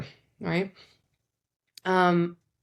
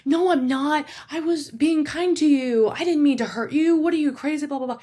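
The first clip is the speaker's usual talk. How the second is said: high, louder, defensive protest; defensive arguing; 10 semitones higher, 4 dB louder than usual. Retold or imitated. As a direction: snap back as if accused, pitch high. Defensive partner arguing back.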